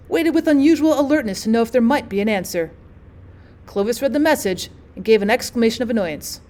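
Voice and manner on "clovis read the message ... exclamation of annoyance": plain reading voice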